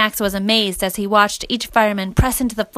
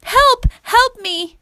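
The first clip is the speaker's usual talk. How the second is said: put-on high, loud girl's cry; acted panicked plea in a high child's voice; scream for help; 5 dB louder, much slower than usual. A narrator's reading. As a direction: call out desperately from far up. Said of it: "he was startled by a scream".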